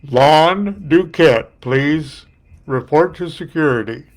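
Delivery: slow, loud PA call; stadium announcer. Retold or imitated. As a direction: stretch the name out over the loudspeaker.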